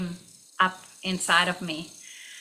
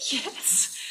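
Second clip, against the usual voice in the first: stunned breathless yes; 6 semitones higher, 5 dB quieter than usual. Retold or imitated. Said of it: "And I'm like".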